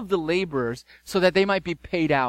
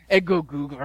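grumbling sound; 3 dB louder than usual. Shown as a demonstration. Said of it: "It's onomatopoeic. It sounds like what it is".

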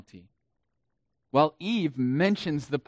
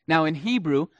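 usual speaking voice

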